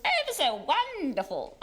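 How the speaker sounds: put-on posh English voice; posh accent. Comedian recalling her half-hour posh role in a comedy series, mock posh accent. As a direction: imitate an over-refined posh accent. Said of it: "talking like that".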